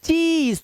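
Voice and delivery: "चीज" with a long, high ee; high, smiling, drawn-out "चीज"; smiling cheese. Shown as a demonstration. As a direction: stretch "चीज" as for a photo smile. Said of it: "मुंह पे smile से आती है".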